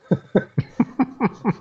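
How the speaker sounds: a quick rising chuckle at his own joke; short laugh. Real, not acted. Laughing at his Mississippi joke, real short laugh. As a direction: let a real little laugh escape.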